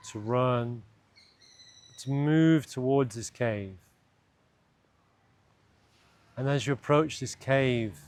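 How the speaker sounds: calm, unhurried meditation voice with long pauses; guided meditation. Acted. Guide leading listeners into an imagined cave, guided meditation. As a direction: lead them gently inward, leaving space between phrases.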